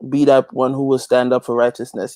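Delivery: normal talking voice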